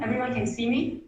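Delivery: normal talking voice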